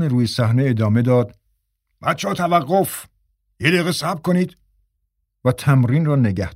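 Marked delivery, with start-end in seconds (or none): 2.0-4.6 higher-pitched call to stop; calling a halt. A narrator's reading. Major stopping the actors on stage, calling a halt. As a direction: call out to the cast. Director halts rehearsal.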